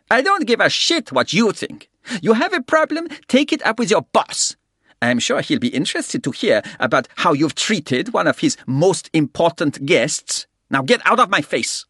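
put-on high, loud German-accented threat. A narrator's reading. German guest threatens the porter, German accent. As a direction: sneer it in a harsh German accent.

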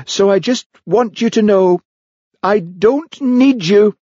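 high, drawn-out melodramatic declaration; theatrical rebuff. A narrator's reading. Heather rebuffs Hamish, high and melodramatic. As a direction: declare it grandly in a high voice, slowing at the end.

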